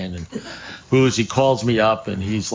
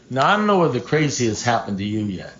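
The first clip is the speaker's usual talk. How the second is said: ordinary talk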